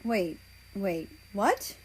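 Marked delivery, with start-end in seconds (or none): none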